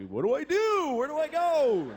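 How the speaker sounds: a lost soul pleading for directions; lost and panicky. Acted. Lost in hell's hallway.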